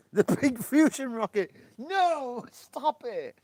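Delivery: high, loud, laughing panic; panicked yelling. Real, not acted. Gamer yelling at the crashing fusion rocket, high and loud. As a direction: cry out as the rocket goes down.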